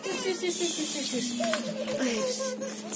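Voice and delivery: high, soft singsong. Real, not acted. Soothing toddler in the lift.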